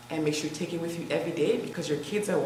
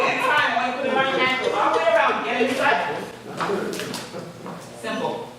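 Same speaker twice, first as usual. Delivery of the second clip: workout coach; 4 semitones higher than usual. Acted. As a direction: count them through the reps. Leads a live workout count.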